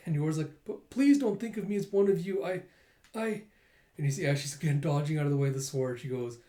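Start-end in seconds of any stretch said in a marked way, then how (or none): 0.6-3.4 high, faltering character voice; plead, then stumble on "I"; protesting plea. Acted. Yor denies being like the assassins, high faltering plea.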